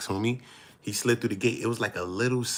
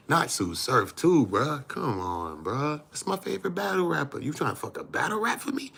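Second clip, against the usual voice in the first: high plea; 7 semitones higher than usual. Real, not acted. Begging Wack to spare his favorite battle rapper, high plea. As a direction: beg him, pitching up.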